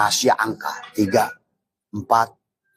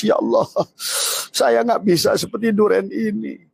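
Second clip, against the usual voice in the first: pleading prayer voice; 13 semitones higher than usual. Retold or imitated. Voices the prayer people should make.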